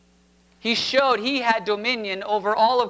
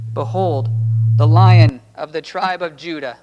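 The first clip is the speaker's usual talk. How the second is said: louder, ringing proclamation of the Lion; proclaiming; 3 semitones lower, 8 dB louder than usual. Acted. The elder proclaims to the weeping John, loud and ringing. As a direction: announce it like a herald.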